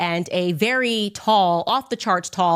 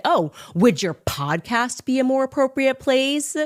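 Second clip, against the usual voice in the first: snarky mock retort; 6 semitones higher than usual. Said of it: "And then he said".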